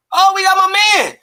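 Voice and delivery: high, loud hype welcome; shouting a big hello to a friend on the phone; excited greeting. Real, not acted. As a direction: hype up the guest coming on. Greeting guest joining the show.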